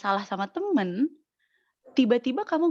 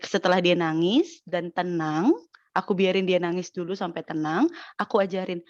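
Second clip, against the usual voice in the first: ordinary delivery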